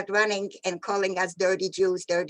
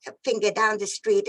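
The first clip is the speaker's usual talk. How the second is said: usual speaking voice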